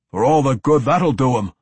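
loud gruff dwarf grumble. A narrator's reading. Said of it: "Athrogate grumbled".

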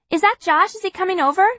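lightly voiced eager kid; a hint of an excited child; little sister voice. Narrator voices Kitty.

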